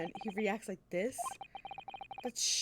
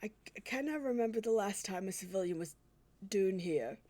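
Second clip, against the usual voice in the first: put-on a hesitant, self-doubting stab at a Scottish accent; the prison governor, in a halting Scottish accent; attempted Scottish accent. Prison governor greeting the visitors, halting Scottish accent. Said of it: "I can't, I can't do a Scottish accent".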